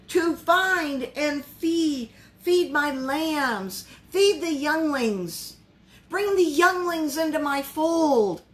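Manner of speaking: slow, weighty, each feed stressed; slow emphatic preaching. Real, not acted. Preacher urging the church to feed the young, slow and emphatic. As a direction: draw out the charge word by word. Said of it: "preacher stresses the charge".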